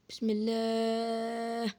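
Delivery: very slow, quiet, stretched-out bismillah; drawn-out bismillah. Real, not acted. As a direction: hold your breath and stretch each word slowly. Nervous before eating the fruit.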